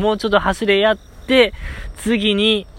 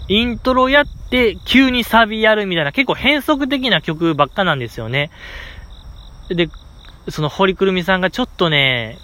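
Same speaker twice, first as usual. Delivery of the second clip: ordinary talk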